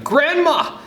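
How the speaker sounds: high, loud call for grandma; excited child's shout. A narrator's reading.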